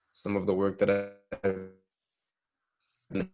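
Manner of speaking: audio cutting out; choppy breaking-up call audio; corrupted glitchy audio. Real, not acted. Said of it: "Your sound is cutting out again".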